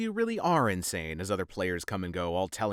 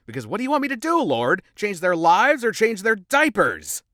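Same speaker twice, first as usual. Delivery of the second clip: exasperated outcry; 10 semitones higher, 9 dB louder than usual. Real, not acted. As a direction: throw up your hands at heaven. Exasperated outburst at the Lord.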